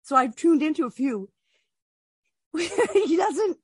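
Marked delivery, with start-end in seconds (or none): 2.5-3.6 high, breaking into a laugh. Real, not acted. Telling co-host about silent streams, laughing. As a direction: start the line through a laugh.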